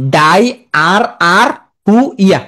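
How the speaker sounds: chunked "ডাই আর আর হু ইয়া", each piece rising; spelling pronunciation. Shown as a demonstration. Teacher turning spelling into sound, slow chunks ডাই আর আর হু ইয়া. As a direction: slowly separate ডাই, আর, আর, হু, ইয়া. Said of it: "পড়বা কি?".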